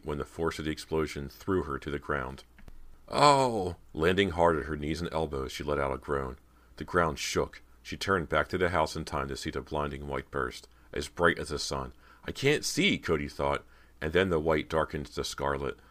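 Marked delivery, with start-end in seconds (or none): 3.1-3.7 high, loud jolted cry; pained gasp. A narrator's reading. Thrown down by explosion. 12.2-13.0 panicked. A narrator's reading. Blinded by flash.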